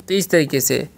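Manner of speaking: usual speaking voice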